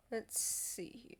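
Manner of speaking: whispering. Real, not acted. GM muttering to himself.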